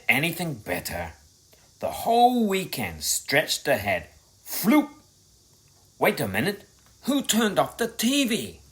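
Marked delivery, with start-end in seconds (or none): none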